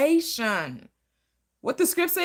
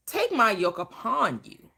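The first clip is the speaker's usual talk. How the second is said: ordinary talk, nothing marked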